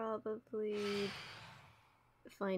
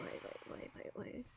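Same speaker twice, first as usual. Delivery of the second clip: the repeated question dropping to a whisper; a whisper to herself, repeating "why am I"; fading to a whisper; 2 semitones higher, 11 dB quieter than usual. Acted. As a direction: let "why am I" fade into a breathy whisper. Talking to herself in embarrassment.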